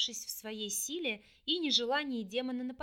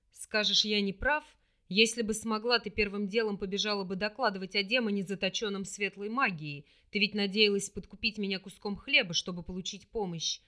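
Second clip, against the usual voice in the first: low, louder accusing demon voice; 3 semitones lower, 5 dB louder than usual. Acted. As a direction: accuse her, hard and low.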